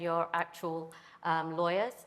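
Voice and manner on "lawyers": normal talking voice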